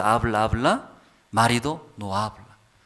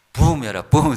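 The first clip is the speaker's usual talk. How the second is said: a louder, punchy "pum"; punch sound effect; 3 semitones higher, 4 dB louder than usual. Retold or imitated. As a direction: mime the blow with your voice. Imitating husband's punch.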